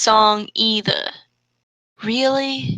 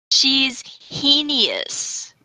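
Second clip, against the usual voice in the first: slow, drawn-out disgusted line; a melodramatic heroine's sneer; dramatic disgust; 2 semitones higher, much slower than usual. Acted. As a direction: savor every syllable of the insult. Fanfic dialogue read dramatically.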